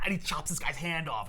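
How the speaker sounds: usual speaking voice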